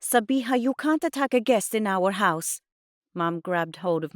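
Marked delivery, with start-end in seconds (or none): 0.0-2.6 raised, louder reprimand; scolding. A narrator's reading. Mum to daughter attacking a guest, scolding. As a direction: scold her, scandalised.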